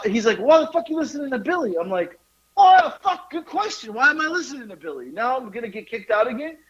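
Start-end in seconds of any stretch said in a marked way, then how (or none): 0.4-1.7 high-pitched mock exasperation; incredulous telling-off, pitched up; retold scolding. Retold driver scolding him. 2.6-6.6 mock dismay at your own gullibility; retold exasperation.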